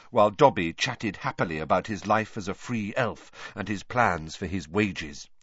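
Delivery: ordinary delivery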